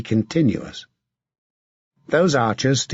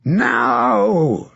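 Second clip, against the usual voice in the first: a commander bellowing the charge; sudden bellowed order to strike; 10 semitones higher, 3 dB louder than usual. Acted. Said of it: "Sir John bellowed".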